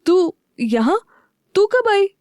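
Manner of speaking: rising high in surprise; startled, pitch jumping up; surprised. A narrator's reading. Husband surprised to see wife in his office, astonished. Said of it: "युवी हैरानी से बोला".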